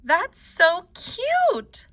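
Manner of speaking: high, drawn-out gush of delight. A narrator's reading. Teacher delighted by her pupils' palm trees, gushing. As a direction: coo it slowly, thrilled and high. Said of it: "she was delighted".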